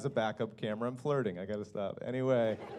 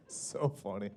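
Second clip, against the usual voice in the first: ordinary talk, nothing marked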